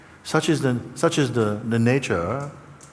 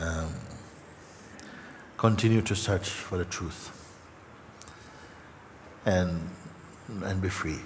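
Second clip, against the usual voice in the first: softer, choked-up quiet close; holding back real emotion; emotional; 3 semitones lower, 9 dB quieter than usual. Real, not acted. Teacher moved while blessing listeners, soft and choked up. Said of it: "Sorry, I feel a bit emotional about".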